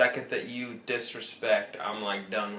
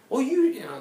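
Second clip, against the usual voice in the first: a much higher, syrupy imitation of the retention agent; high mock sweet agent; 13 semitones higher, 5 dB louder than usual. The caller mimics the cable rep pitching deals, high mock-sweet voice. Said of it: "she would come up with this nice thing".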